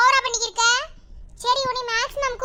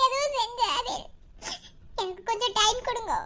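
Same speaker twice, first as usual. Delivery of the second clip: subdued pleading; 8 dB quieter than usual. Acted. Pleading for time after threat.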